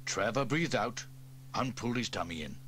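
ordinary delivery, nothing marked

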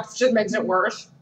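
ordinary talk, nothing marked

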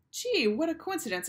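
high, loud mock-amazed "gee"; sarcastic teacher. A narrator's reading.

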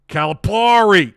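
"Calipari" with a loud, high, stretched PAR; defiant mispronunciation. Shown as a demonstration. Streamer defies Mike's correction, "Calipari" said big with a drawn-out PAR. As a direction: punch and draw out the third syllable of "Calipari". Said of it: "pronounce things however I want".

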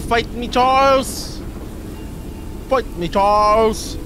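slow, drawn-out battle call; mock challenge. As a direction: stretch it out like a playful challenge. Calling out an opponent in game.

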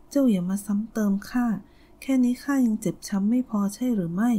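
plain reading voice

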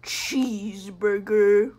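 loud, slow, low drawn-out bellow; comic bellow. Pretend. Player blurts out one silly word, comic bellow. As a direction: boom the word out, stretching it slowly. Random performed exclamation in game.

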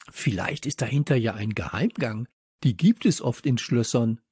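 usual speaking voice

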